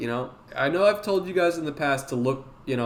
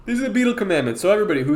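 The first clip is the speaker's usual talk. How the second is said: ordinary talk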